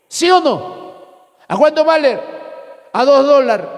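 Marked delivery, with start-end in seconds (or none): 2.9-3.7 "A dos dólares" with the S swallowed, "a ðo ðolaɾ"; coastal pronunciation, dropped S. Shown as a demonstration. Preacher mimics coastal speech to the congregation, S swallowed. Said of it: "No dice a dos dólares".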